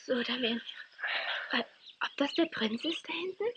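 soft, hushed voices. Acted. Friends arriving at the prince's camp, hushed and awed.

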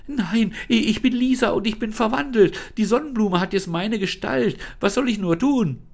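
put-on high, bright girl's voice, worried and pleading; girl Lisa, anxious. Trapped girl Lisa begs the unseen voice, high and worried.

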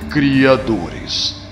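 usual speaking voice

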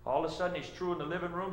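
ordinary talk

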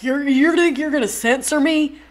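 loud, high, indignant Southern husband; outraged Southern man impression; Southern accent. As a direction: put on an affronted Southern accent. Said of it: "I'm doing a Southern accent".

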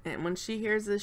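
usual speaking voice

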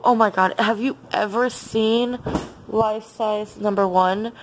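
usual speaking voice

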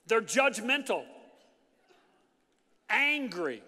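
loud, high preaching; a pastor preaching at full force about offended people. Real, not acted. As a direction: drive it home from the pulpit.

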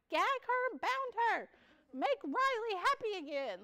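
high, loud hammed-up demand; mock-menacing high cry; mock villain. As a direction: ham it up like a cartoon kidnapper. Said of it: "I'm like".

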